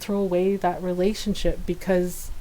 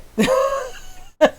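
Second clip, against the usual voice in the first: high crying laugh. Real, not acted. Laughing at memory.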